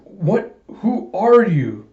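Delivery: high, drawn-out incredulous question; acted astonishment. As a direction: ask in amazed disbelief, stretching it out. Character astonished at Alita.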